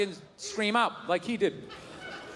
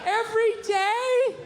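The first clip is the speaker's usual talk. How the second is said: high, slow, doubtful wife voice; skeptical wife; 10 semitones higher, 4 dB louder, much slower than usual. Retold or imitated. Wife doubting the comic's daily shower excuse, high slow. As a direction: drag it out, disbelieving and high.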